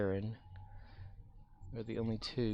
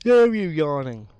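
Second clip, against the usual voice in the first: loud, slow, high frustrated curse; frustrated yell; 6 semitones higher, 13 dB louder than usual. Real, not acted. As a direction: shout it at the screen. Frustrated at game.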